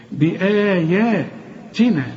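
بآياتنا recited slowly with even long vowels; slow tajweed reading of بآياتنا; Quran recitation. Shown as a demonstration. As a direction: chant بآياتنا with measured elongation. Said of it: "وهكذا يقرأ".

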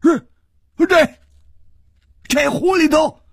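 high, loud quavering disbelief; stammer, then wail in despair; shaking, anguished outcry; trembling voice. A narrator's reading. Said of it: "这个人颤声说".

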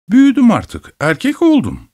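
high, eager protest of being grown; proudly insist, pitch jumping high; high-pitched childlike bragging; boyish boasting. A narrator's reading.

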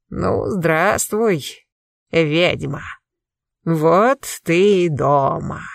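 put-on slow, crackling, gloating old sorcerer; crackling old man's voice. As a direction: drag it out like a cruel old warlock. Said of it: "Трескучий старческий голос".

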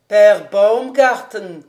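very loud, slow summons; loud drawn-out call for the landlord. A narrator's reading. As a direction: call the innkeeper from across the garden. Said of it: "criait le professeur Speck".

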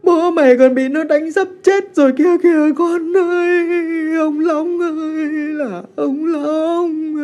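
high, loud, tearful screaming, slow; crying and screaming. A narrator's reading. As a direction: sob and scream to be let go. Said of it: "vừa khóc mà vừa gào lên". (vừa khóc vừa gào)

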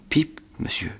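high, soft frightened whisper; boy repeating his name in fear; scared little voice. Acted. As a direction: squeak it out in terror.

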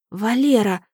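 plain reading voice

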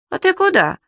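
alarmed little questions; worried creature chasing after dad; flustered. A narrator's reading. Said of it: "разволновался Субастик".

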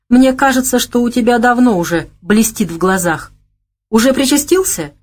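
gently chiding him for drinking; slightly reproachful. A narrator's reading. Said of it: "чуть укоризненно проговорила Лолита". (с лёгким укором)

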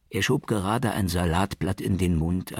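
ordinary delivery, nothing marked

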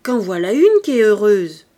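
put-on loud, envious toothless old woman; vieille édentée qui grommelle. A narrator's reading. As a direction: grumble loudly as an old crone. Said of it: "grommela une vieille édentée". (vieille édentée, grommelant d'une voix forte)

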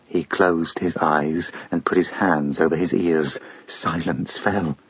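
ordinary delivery, nothing marked

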